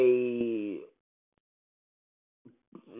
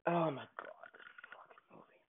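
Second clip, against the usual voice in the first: breathed-out exasperated whisper; whispered despair; 19 dB quieter than usual. Real, not acted. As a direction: give up on the film under your breath. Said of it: "despairing mutter".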